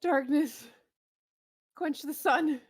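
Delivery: high, loud dramatic spell-casting voice; enemy spellcaster's incantation. Acted. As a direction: call down darkness with commanding force.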